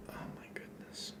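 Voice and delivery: whispered under breath; soft whispered aside. Real, not acted. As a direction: breathe it out, barely voiced. Quiet aside during game.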